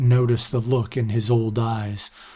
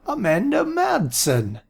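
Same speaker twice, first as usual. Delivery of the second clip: put-on high, slow, startled old man; surprised old man; 12 semitones higher than usual. A narrator's reading. Aaron spotting Amanda, high and slow with surprise. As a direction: greet her as if she just appeared. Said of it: "in a surprised tone".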